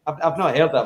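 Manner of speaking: usual speaking voice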